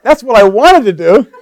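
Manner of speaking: high, loud, laughing through the words; laughing confession. Retold or imitated. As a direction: blurt it out amused at yourself. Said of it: "And I thought".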